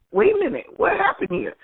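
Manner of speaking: high, startled mock alarm; a sudden retold surprise pitched high; surprised. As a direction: retell the double take with pitch jumping up. Mother walks in on son's moment.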